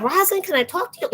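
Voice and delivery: mock pleading, high; high pleading mimic. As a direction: play the begging client, high.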